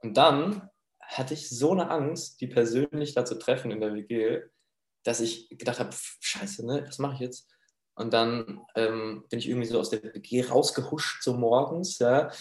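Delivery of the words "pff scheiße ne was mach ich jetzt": whispered, panicky self-talk; anxious whisper. Retold or imitated. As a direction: breathe it out in a frightened whisper. Panicking alone, afraid of roommate. (leise und panisch geflüstert)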